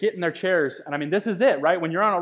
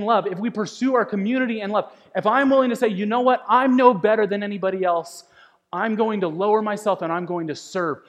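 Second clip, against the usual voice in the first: preaching at full force; 4 semitones higher than usual. Acted. Preaching climax.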